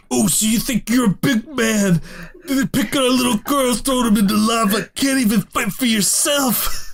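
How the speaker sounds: high, taunting bully voice; mocking villain voice. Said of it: "he says".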